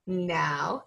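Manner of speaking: normal talking voice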